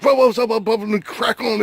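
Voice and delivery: high, fast excited gibberish; mock overexcited rapid-fire jabber; Robbie Cole, excited babble. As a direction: babble excitedly like you can't be understood. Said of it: "he starts talking so fast".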